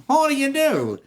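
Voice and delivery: high, loud jealous husband's hello; pointed greeting. Acted. Jealous husband greeting the supposed island man, pointed. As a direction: throw the greeting back hard and high.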